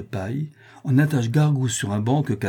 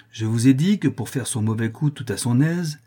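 ordinary delivery

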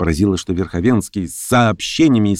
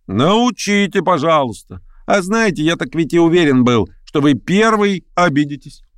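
sarcastic retort; 9 semitones higher than usual. Acted. Sarcastic retort in an acted quarrel.

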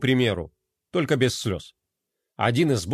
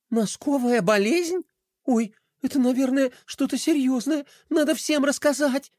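worried little princess; 10 semitones higher than usual. A narrator's reading. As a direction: fret over the strange illness. Princess character voice. (высокий встревоженный голос принцессы)

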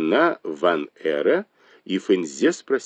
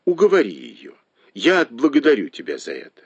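high-pitched, eager urging; quick, high exclamation of entreaty; exclaiming plea; 7 semitones higher, faster than usual. A narrator's reading. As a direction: plead with her in a raised, excited pitch. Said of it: "воскликнул Дзялянь".

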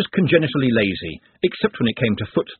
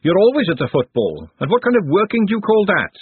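scolding; 9 semitones higher, 5 dB louder than usual. Acted. Glenda scolding Trev.

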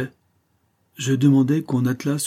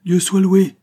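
high, loud burst of relief; relieved cry; 10 semitones higher, 7 dB louder, slower than usual. A narrator's reading. Relieved woman greets the groom.

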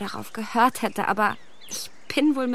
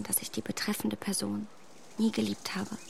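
ashamed whisper; 8 dB quieter than usual. Acted. Emma's ashamed confession.

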